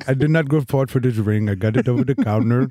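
hammed-up Indian accent, eager suitor; mock Indian accent. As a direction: promise the world in a comic Indian accent. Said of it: "That's very good".

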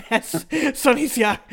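amused, laugh breaking in. Real, not acted. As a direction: start the line while cracking up. Laughing while retelling the yell.

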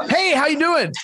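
high bright hello; upbeat greeting. Shown as a demonstration. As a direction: sound thrilled to call. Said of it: "it's just".

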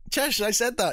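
husband insisting to his wife that he got it right; indignant protest. Retold or imitated. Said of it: "and I'm like".

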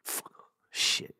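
a sharp whispered shit, under his breath; whispered curse. Real, not acted. Caught swearing on air, quick whispered curse. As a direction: hiss it to yourself after a slip. Catches himself swearing mid-word.

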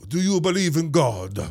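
high, loud hammed-up Italian priest accent; mock Russell Crowe's cartoonish Italian accent; over-the-top fake Italian accent, pitched high; bad Italian accent. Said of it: "It's a bad accent".

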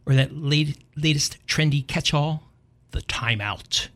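ordinary talk, nothing marked

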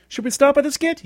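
very high, loud mock host voice; imitating Lorne Michaels. Lorne Michaels ending the skit, high and loud. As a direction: play the producer cutting the skit. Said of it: "When Lorne Michaels comes out".

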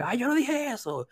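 lightly voiced high whiny passenger; mock petulant protest; whiny denial. Passenger denies refusing food.

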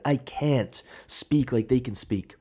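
ordinary talk

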